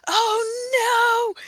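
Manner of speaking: high, hammed-up cry of dismay; exaggerated squeal of alarm; mock dismay. Voices Miko seeing the scar.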